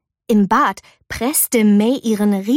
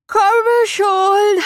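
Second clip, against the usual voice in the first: sleepy call; 11 semitones higher, 4 dB louder than usual. A narrator's reading. Mei lazily calling from bed.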